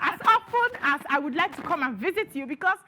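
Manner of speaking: high-pitched, worked-up protest; heated outburst. Real, not acted. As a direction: say it like you're fed up and insisting. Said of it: "Daddy, don't mind her".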